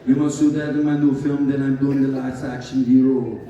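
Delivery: loud Austrian accent, imitating Arnold; Schwarzenegger accent. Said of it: "and I'll try to do his accent".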